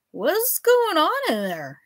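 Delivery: put-on a nosy drunk peering past the door; drunk professor. A narrator's reading. Drunk professor voiced in story.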